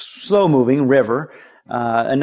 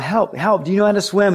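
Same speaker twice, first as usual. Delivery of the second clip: mild frantic cry for help; 6 semitones higher than usual. Retold or imitated. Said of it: "she starts crying out".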